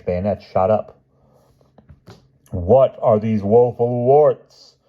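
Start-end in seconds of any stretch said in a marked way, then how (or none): 2.5-4.8 slow, higher, louder storybook complaint; not muttered, slowly wailed in dismay; complaining little Jack. A narrator's reading. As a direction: lament it loudly, drawing it out. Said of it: "muttered little Jack".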